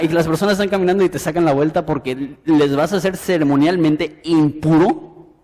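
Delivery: usual speaking voice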